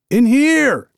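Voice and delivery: high loud shout for help. Acted. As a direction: call out loud to be found. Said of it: "Amos shouted".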